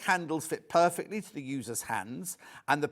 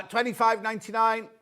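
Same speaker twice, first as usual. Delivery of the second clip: ordinary delivery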